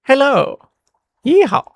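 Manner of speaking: high, loud playful yeehaw; hammed-up cowboy holler; mock cowboy yeehaw. Player greeting the cowboy merchant with a mock holler.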